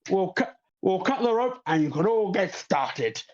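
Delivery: a hint of the host starting the festival; showman host. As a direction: wrap it up briskly like an emcee. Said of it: "Leo goes".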